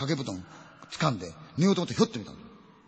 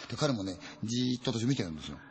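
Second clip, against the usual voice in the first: ordinary talk, nothing marked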